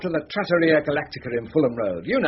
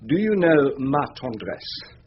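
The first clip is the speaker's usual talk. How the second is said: slow, lingering question; 3 semitones lower, slower than usual. Acted. Head of chambers slowly tempts young barrister with perfume name.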